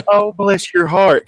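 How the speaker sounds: high, slow Southern pity; mock Southern sympathy. Echo tech consoling the bee-sting patient, exaggerated Southern sympathy. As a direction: coo it like an imitated Southern woman. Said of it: "'cause that's a Southern thing".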